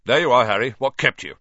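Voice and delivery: quick, businesslike greeting; brisk. A narrator's reading. Team captain hurrying his late seeker, brisk. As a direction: snap it out briskly to the latecomer. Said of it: "said Wood briskly".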